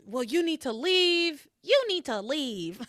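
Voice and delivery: slow, drawn-out you need to leave; mock viral clip delivery; viral meme voice. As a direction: drag out each word like the meme. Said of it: "I don't know if you heard that".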